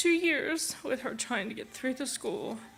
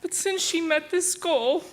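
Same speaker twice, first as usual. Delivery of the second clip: higher, louder, pushing on through real tears; tearful; 7 semitones higher, 5 dB louder than usual. Real, not acted. Grandma praising her graduating grandchild at the podium, tearful. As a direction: voice lifted by crying. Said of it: "Both of us crying".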